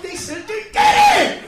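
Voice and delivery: high, slow, furious yell; outraged shout. Real, not acted. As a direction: shout it at the top of your lungs.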